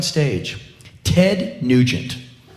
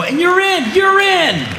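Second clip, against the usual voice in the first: high-pitched celebratory shout; mock triumph; 4 dB louder than usual. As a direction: welcome them in, hammed-up jubilation. Mock celebration of passing English test.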